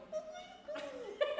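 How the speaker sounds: high, loud imitated rooster crow. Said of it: "I always love the sound".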